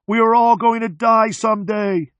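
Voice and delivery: high, loud scream. A narrator's reading. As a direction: scream at death head-on. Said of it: "the mechanic screams".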